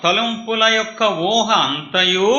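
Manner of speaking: high, loud, slow verse repeat; preached echo. Acted. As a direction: stretch every word out to the crowd. Preacher echoes verse loudly.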